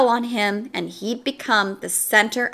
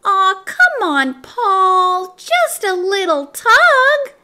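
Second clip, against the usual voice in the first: high, slow, wheedling little voice; tiny tempter pushing harder; urging pigtail; 9 semitones higher, 5 dB louder, slower than usual. A narrator's reading. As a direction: coax him with drawn-out pleading. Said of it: "urged the pigtail".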